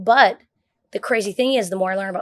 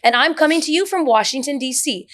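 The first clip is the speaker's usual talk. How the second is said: higher, louder polished news anchor read; newscaster read; 4 semitones higher, 4 dB louder than usual. Shown as a demonstration. Showing friends how a newscaster talks, news anchor read. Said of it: "And they said newscaster".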